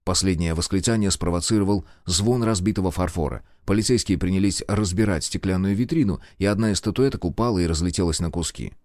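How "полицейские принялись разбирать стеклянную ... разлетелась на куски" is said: plain reading voice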